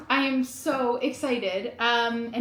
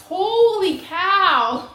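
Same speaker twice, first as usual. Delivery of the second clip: slow, much higher and louder amazed exclamation; real surprise; 8 semitones higher, 8 dB louder, much slower than usual. Real, not acted. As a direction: stretch it out in sheer astonishment. Opening surprise package.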